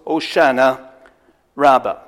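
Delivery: "Hosanna Rabbah" said as Hebrew, stress on RAB; Hebrew pronunciation. Shown as a demonstration. Preacher giving the Hebrew phrase, stress on RAB. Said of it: "it goes".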